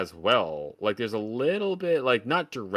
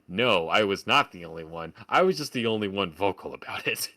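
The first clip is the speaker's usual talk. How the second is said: normal talking voice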